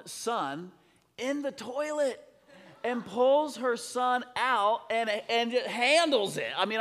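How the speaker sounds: high, emphatic celebratory preaching; fired-up preacher's exclaiming; excited preaching. Real, not acted. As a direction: proclaim it to the crowd in amazement.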